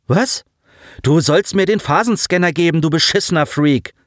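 gereizt anblaffen, höher; wütender Junge blafft seinen Kollegen an; blaffend. A narrator's reading. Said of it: "blaffte der kräftige Junge". (mittelstark gespielte Wut)

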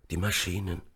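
soft, faltering dying voice; feeble stammer. A narrator's reading. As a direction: barely get the words out. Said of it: "Seine Stimme klang kraftlos". (kraftlos gestammelt)

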